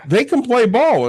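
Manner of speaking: teasing his partner by parroting her vouching for the family; mock imitation. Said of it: "And you looked at it and goes".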